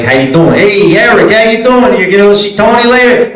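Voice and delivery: high, exaggerated Brooklyn street greeting; Brooklyn accent. Coach imitating Brooklyn friends, hammed-up Brooklyn accent. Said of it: "they all talk like that".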